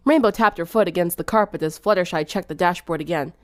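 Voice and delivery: ordinary delivery, nothing marked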